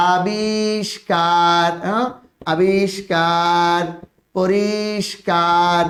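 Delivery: each word stretched very slowly; slow spelling demo. Shown as a demonstration. Teacher listing examples, slow and drawn out. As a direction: dwell on every word for the class. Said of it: "কি দেখো".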